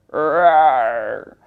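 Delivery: low growling roar; lion roar. Acted.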